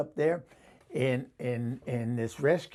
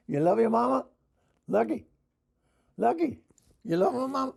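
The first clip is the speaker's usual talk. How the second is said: coaxing a pet; 8 semitones higher, 3 dB louder, faster than usual. Real, not acted. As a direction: coax the dog in a high voice. Coaxing her dog.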